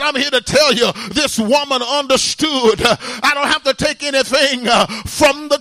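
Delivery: loud, rhythmic sermon delivery with uh breaths; fiery preaching. Acted. Preacher urging the church to call on God, fiery preaching. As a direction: preach it hard to the congregation.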